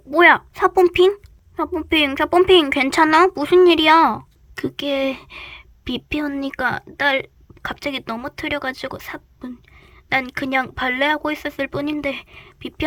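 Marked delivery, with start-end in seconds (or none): 0.1-4.2 alarmed concern. Acted. Kids rush to fallen friend. 9.0-9.6 breathless whisper of her catchphrase. Acted. Hurt ballerina whispers her tag word softly.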